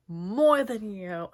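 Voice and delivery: put-on slow, loud drunken taunt. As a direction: drag it out like a sloppy drunk. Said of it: "Woody Harrelson point at the camera, go".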